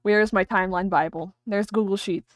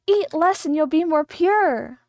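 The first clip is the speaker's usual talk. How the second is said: preachy cult motto; 6 semitones higher, 7 dB louder, slower than usual. Retold or imitated. Voicing the cult's motto.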